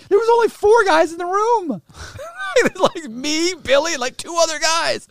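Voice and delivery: high, laughing disbelief; laughing incredulously. Real, not acted.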